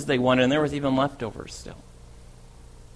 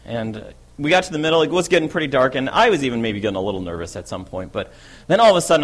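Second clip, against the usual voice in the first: ordinary talk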